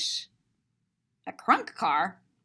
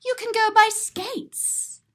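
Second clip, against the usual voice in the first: higher bouncy storybook lilt; sing-song; 9 semitones higher than usual. Acted. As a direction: chirp it brightly. Sing-song Seuss reading.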